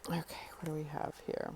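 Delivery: soft fast whisper. Real, not acted. Reader gathering herself after tears, whispering. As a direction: breathe the words out unvoiced.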